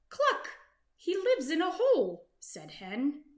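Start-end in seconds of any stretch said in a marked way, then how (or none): 0.1-0.5 very high, louder hen cluck; startled cluck. Acted. 0.9-2.3 higher, louder shocked hen. Acted. Hen's shocked line.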